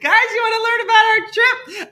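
high, loud, laughing sales pitch; laughing mock pitch. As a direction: giggle through a cheesy tour pitch. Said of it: "You're just like".

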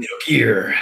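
put-on gruff voice; gruff game-hero voice. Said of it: "like that really gruff".

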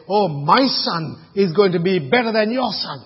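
hammed-up proud mum; boastful mother. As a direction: show off your son to the other mother. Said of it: "She didn't say".

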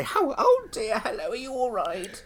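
high, sugary fussing voice; mock concerned host. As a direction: coo at an old lady like a nurse. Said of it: "And I say".

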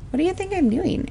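high, sarcastic retort to the trooper; sassy retort. Retold or imitated. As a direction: toss it back with attitude. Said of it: "And I'm like".

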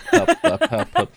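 mock cry for help. Mock panic to mods.

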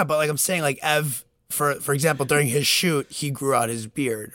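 ordinary delivery

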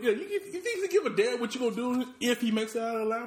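high voice, laughing through the question; laughing rant. Real, not acted. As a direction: laugh while you mock the threat. Laughing at the cop scene.

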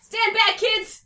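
high, loud warning shout; full-volume high holler; yell. A narrator's reading. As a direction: shout a warning to get clear. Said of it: "yelled Stu".

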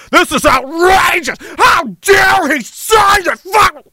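mock the fake moral outrage; drawn-out outraged yell, slow and high. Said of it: "pretend like".

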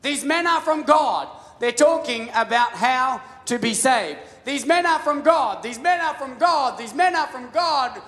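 put-on high, loud, slow shout of the possessed girl; hammed-up chanting yell; mock yelling. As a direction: cry it out over and over. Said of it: "just yelling at them repeatedly".

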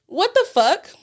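usual speaking voice

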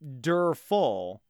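"wonderful" dragged out very slowly, leaning on "derful"; stretched-out word. Shown as a demonstration. Cohost picks apart the card pun, dragging out the word slowly. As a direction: stretch "wonderful" slow as taffy to expose "derful". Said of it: "okay, what is derful?".

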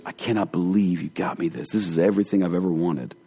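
low, hushed acted disbelief; softly overwhelmed by a gift, lightly acted; quiet amazement. Acted gift recipient, deeply moved.

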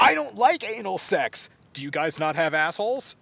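loud, high mock outrage; mock indignant quote. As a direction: read it like an offended poster. Quoting a funny forum post.

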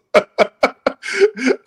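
big high burst of laughter; real laugh at the irony; loud laugh. Real, not acted.

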